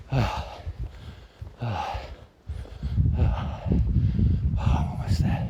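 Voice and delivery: winded, barely voiced; gasping the words out between breaths; breathless whisper. Real, not acted. Panting up the hill.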